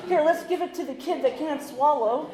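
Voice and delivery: mock their bright idea; sarcastic mockery. Mocking staff logic.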